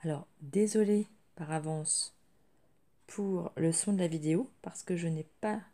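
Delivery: speaking through a sore throat; strained sore-throat voice; swollen tonsils voice. Real, not acted. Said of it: "Donc je parle un peu bizarre".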